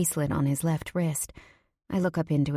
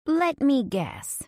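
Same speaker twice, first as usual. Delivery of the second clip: high, drawn-out knowing tease; teasing; 9 semitones higher, much slower than usual. A narrator's reading. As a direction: stretch it out slowly and high, teasing. Teasing friend begins guessing.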